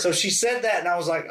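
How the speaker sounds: usual speaking voice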